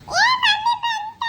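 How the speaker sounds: high, loud, drawn-out cheer; joyful shout. Acted. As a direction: cry out as mummy finally arrives. Child sees mother has returned. (ಸಂಭ್ರಮದಿಂದ ಕೂಗಿ)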